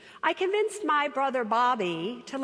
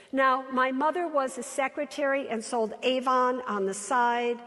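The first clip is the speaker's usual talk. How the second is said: ordinary delivery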